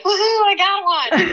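high, squeaky mock victory whoop; squealing I got one in a high voice; mock cheer. Retold catching small pike.

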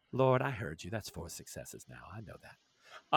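low, soft comic-preacher voice; a quiet, fading Cat Williams bit; Cat Williams impression. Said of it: "to do Cat Williams".

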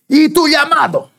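high, slow, drawn-out call like a trumpet blast; God's booming call. Retold or imitated. God confronts the runaway pastor, booming and slow. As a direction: ring out like a giant horn from heaven. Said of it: "Como si fuera un grito".

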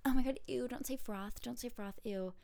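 hammed-up panicky protest, held back in volume; mock screaming. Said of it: "just start screaming like".

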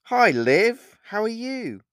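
high, loud, beaming greeting; over-keen, high-pitched hello; wide-eyed smile. A narrator's reading. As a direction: greet her brightly through a big smile. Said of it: "with a wide-eyed smile".

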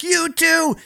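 high-pitched imitated cop yell; mock a cop yelling an order. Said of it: "He's yelling at us".

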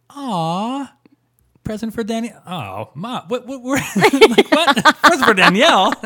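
high-pitched dismayed "aw", building to loud bafflement; hammed-up baffled outrage; mock disbelief. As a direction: retell a letdown turning into loud, squeaky incredulity. Acted reaction to misaddressed gift.

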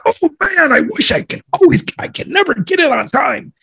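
high whiny mock-employee voice; hammed-up high voice of a sorry latecomer; mock frustrated employee.

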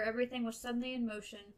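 plain reading voice

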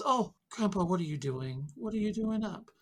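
a hint of a fussing aunt; aunt chiding grandpa for being up; aunt scolding. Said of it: "she says".

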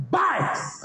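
yelled tic. Shown as a demonstration. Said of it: "I just yell it".

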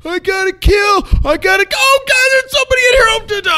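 high, loud yell turning from triumph to panic; yelling at top of lungs. Retold or imitated. Gamer running into the building finds an enemy, yelling turns panicked. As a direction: re-enact a victory shout that breaks into screaming alarm. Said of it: "yelling at the top of my lungs".

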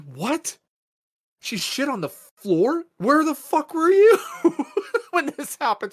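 high, loud outburst that climbs steeply; mad, incredulous. Retold or imitated. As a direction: retell it as a drawn-out, outraged demand. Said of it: "So I'm mad at Carly".

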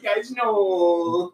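high whiny plea; whining voice. Retold or imitated. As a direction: whimper it out, pitiful. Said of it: "classic Will Forte whining voice".

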